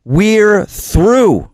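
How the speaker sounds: "We're through" lifted high and held. Acted. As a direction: answer back up high, with resolve. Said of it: "responses that I wrote".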